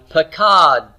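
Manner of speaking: "pakad" slowly, weight on KAD, t released; final-syllable stress. Shown as a demonstration. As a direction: say "pakad" as pa-KADT. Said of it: "is the final syllable".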